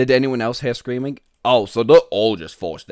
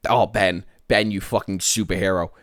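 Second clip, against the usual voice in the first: normal talking voice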